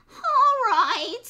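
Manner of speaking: high, loud resigned piglet; sighing piglet. A narrator's reading. Piglet sighing, giving in to the fox. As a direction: give in with a sigh. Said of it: "sighed the piglet".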